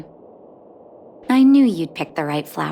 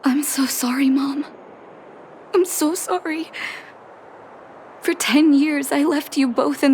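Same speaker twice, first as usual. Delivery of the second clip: high, breaking apology; grieving apology; 7 semitones higher than usual. Acted. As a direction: whisper the first, then break. Learning father died.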